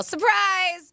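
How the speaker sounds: high, drawn-out shout of surprise; mock surprise yell. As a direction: call it out high, like springing a surprise party. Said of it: "start recording and yell".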